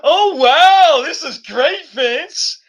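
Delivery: gushing mock Sonya. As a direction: gush over the egg like a starstruck fan. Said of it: "Sonya Deville's like".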